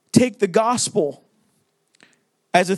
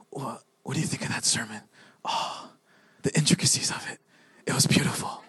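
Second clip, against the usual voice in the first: hushed, awestruck whisper; whispered mock reverence; 11 semitones lower, 8 dB quieter, faster than usual. Churchgoers critiquing a sermon like art, whispered awe. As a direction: whisper it like an art gallery visitor. Churchgoers mimicked as gallery visitors.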